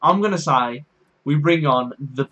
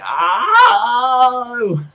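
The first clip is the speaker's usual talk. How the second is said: yelped high, loud cry of shock; the noise I made; 8 semitones higher, 8 dB louder than usual. Shown as a demonstration. Recalling the goal, a loud high yelp. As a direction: let out a sudden pained-joy yelp. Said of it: "That was the noise I made".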